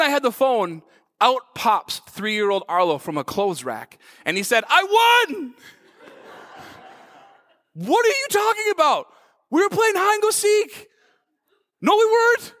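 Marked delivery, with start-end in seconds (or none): 4.7-5.5 put-on small child. Three-year-old son pops out triumphant. 7.7-12.5 put-on high little kid's voice; small child. Three-year-old son voiced in story.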